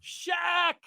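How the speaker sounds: high, laughing shouted plea; kid shouting. Retold or imitated. Storyteller imitating a kid chasing Shaq, high laughing shout. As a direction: call out after him like an eager kid. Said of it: "some kid is running after him".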